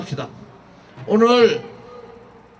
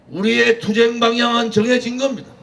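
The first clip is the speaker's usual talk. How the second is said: usual speaking voice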